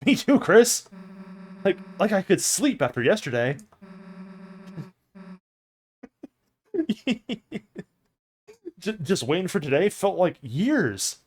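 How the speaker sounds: high, loud excited chatter; bubbling over with excitement; eager girl voice. Acted. Susie excitedly joins in.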